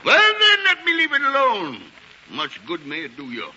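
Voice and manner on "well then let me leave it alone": high, loud angry cry; angry outburst. Acted. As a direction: throw the words up in a rage. Scrooge explodes at nephew.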